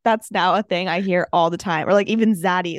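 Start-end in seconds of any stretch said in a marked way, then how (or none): 2.3-2.8 "Zaddy" with a buzzing Z where the D goes. Shown as a demonstration. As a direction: say "Zaddy", daddy with a Z. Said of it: "put Z at the beginning of, like, a D word".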